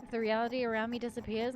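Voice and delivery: plain reading voice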